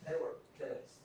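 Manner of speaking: they were pissed whispered as an aside; whispered repeat. Real, not acted. Repeated for emphasis.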